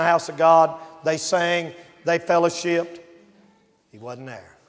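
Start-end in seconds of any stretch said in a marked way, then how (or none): 3.9-4.6 low, very soft and grave; hushed storytelling. Real, not acted. Preacher telling of the missing boy, hushed. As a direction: drop to a near hush. Grave hush in tragic tale.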